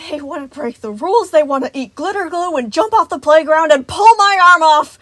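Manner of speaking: high shouted complaint, building to a yell; frantic, exasperated tirade; crazed ranting. A narrator's reading. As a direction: rant on and on, overwhelmed. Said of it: "ranting on and on".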